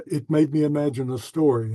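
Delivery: normal talking voice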